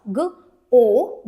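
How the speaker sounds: "ग, ओ" as two separate sounds; short "ग", pause, long "ओ"; sounding out. Shown as a demonstration. Teacher shows an open syllable, sounding out "ग, ओ". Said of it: "ये अपना long vowel sound करेगा".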